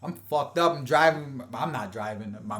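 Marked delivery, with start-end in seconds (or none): none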